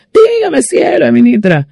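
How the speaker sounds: very high, falling singsong reply; mimicked young seller. The painting seller answering her call, high mimicked reply. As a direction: imitate an obliging answer, starting way up high.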